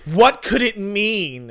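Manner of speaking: hammed-up anguish to the sky; mock dramatic cry. Character crying dramatically towards the sky, mock anguish. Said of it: "looking dramatically towards the sky, crying, going".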